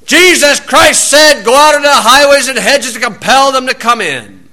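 high, loud ringing quote; loud scripture. Retold or imitated. Verse echoing in the heckler's head at night, loud. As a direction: boom the verse in his head. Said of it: "he heard".

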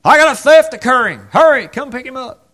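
hammed-up cry to the soldiers; calling out a theft, mock urgent; mock call for help. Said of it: "calling the Roman soldiers and saying".

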